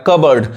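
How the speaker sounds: कबर्ड with no p, "kub-urd"; silent P. Shown as a demonstration. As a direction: say कबर्ड dropping the p. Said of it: "ये पी silent है".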